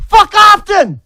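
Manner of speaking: full-throated slow screaming; unhinged screamed order; furious scream. Real, not acted. Raging at critics.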